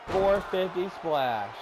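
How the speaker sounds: slow, building play-by-play call; wrestling commentator. Acted. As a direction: stretch the move name for drama.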